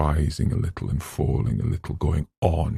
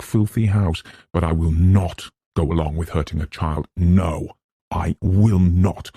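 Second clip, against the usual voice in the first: higher, louder determined voice; defiant resolve; 3 semitones higher, 3 dB louder than usual. Acted. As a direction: steel yourself against the fear. Eleanor summoning courage.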